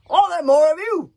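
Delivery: loud, high barked question. A narrator's reading. Warlord barks at the dripping boy, loud and high. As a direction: bark it at the prisoner. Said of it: "barked Norbert the Nutjob".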